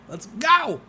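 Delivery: high cheer breaking into a laugh; a high gleeful cheer that dissolves into laughter. Real, not acted. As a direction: cheer him on, then crack up. Celebrating friend's partner news.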